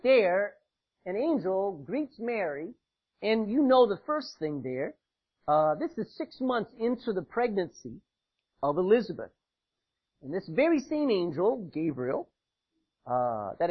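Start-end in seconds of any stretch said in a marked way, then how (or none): none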